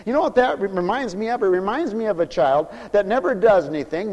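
normal talking voice

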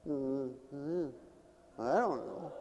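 quiet, low non-answer; soft, small shrug of a reply; kids not knowing. Retold or imitated. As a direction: mumble it like a clueless kid. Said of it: "And the kids".

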